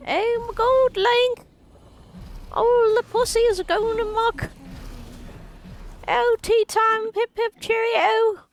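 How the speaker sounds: very high, loud, slow mock British cheer; over-the-top British accent, hammed-up; bad British accent. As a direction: toast like a cartoon Brit at tea time. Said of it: "not even good at doing a British accent".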